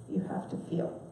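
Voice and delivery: hushed, breathy-free whisper to the congregation; whispered punchline of a sermon; dramatic whisper. Real, not acted. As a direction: drop to a whisper to land the point. Preacher drives home his point.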